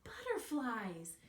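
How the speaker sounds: a little bear shouting with joy; excited cry. Acted. Alfie wakes and cries out to his mother, thrilled. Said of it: "cried Alfie".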